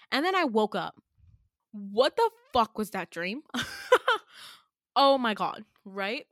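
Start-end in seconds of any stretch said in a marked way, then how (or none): 3.5-4.5 a high breathy burst of laughter. Real, not acted. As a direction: laugh at how absurd the dream was. After telling the dream.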